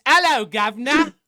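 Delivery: mock British greeting. Shown as a demonstration. Host corrects Owen with a mock British hello. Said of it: "That's the wrong saying, Owen. It's".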